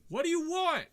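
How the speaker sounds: high, far-off reply from inside; distant guard answering from far away; faint distant voice. Acted. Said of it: "like, a faint".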